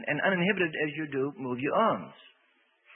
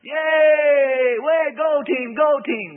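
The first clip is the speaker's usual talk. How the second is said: much higher, much louder cheer; cheering shout; 12 semitones higher, 11 dB louder, much slower than usual. Retold or imitated. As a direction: yell like a fan at a game. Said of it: "or they're yelling".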